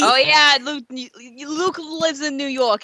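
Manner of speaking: brash New Jersey bird voice; New Jersey accent. Pretend. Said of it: "New Jersey accent".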